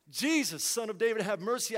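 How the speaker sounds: insistent appeal, pitched to carry; desperate plea. Acted. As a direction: call out as a desperate man would. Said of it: "this guy is desperate".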